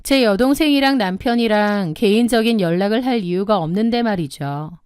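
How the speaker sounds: usual speaking voice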